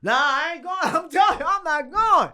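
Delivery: loud, high-pitched protest; hammed-up whiny refusal; acted stubborn refusal. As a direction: refuse loudly, voice pitched right up.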